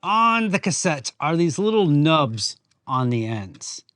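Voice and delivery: ordinary talk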